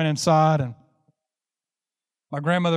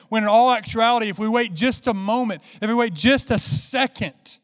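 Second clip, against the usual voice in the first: raised, driving revival-preacher voice; impassioned preaching; 5 semitones higher than usual. Acted. Preacher urging church to stop snacking on fake things, impassioned preaching. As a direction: preach it with rising urgency, punching key words.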